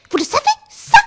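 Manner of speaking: high, loud indignant cry; indignant outcry. Acted. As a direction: cry it out in outrage. Indignant accusation, crying out.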